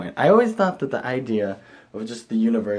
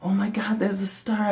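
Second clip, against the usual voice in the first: high, excited; a higher, excited gasp; mock excitement; 10 semitones higher than usual. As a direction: spot a star thrilled. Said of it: "I'm like".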